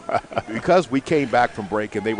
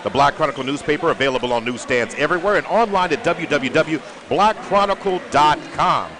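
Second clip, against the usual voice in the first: upbeat higher ad read; sponsor ad read; 7 semitones higher, 5 dB louder than usual. Acted. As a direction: sell the sponsor brightly.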